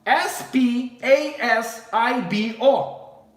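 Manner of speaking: slow letter-by-letter S P A S I B O; careful spelling out; spelled out. Shown as a demonstration. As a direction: spell S P A S I B O one letter at a time, slowly. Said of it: "but it's".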